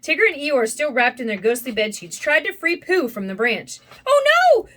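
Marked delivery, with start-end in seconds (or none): 4.1-4.7 very high, loud wail; high-pitched storybook panic; alarmed cry. A narrator's reading. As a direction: cry out in horror. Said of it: "Piglet cried when he saw them".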